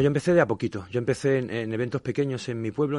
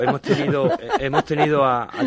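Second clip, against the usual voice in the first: laughing; 4 semitones higher, 7 dB louder than usual. Real, not acted. Laughing at the stalker joke.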